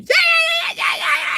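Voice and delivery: extremely high-pitched, garbled mock squeal; screech. Comedian imitating an over-excited woman, mock screech. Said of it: "your voice gets like this screech sound".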